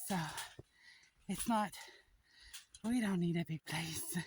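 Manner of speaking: ordinary talk, nothing marked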